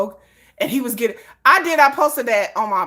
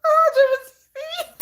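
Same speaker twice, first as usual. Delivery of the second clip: giggly fangirl; much slower than usual. Retold or imitated. Fan working for [name], swooning high like a giggly twelve-year-old. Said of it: "giggling like a twelve-year-old".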